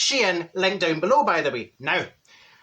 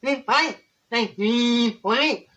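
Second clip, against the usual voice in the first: sarcastic agreement; 4 semitones higher, much slower than usual. Retold or imitated. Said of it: "And I went".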